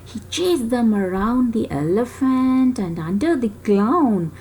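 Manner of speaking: ordinary delivery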